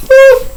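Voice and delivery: very high, loud "woo" of disbelief; sharp high whoop. Real, not acted. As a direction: let out a stunned whoop. Reacting to year sentence.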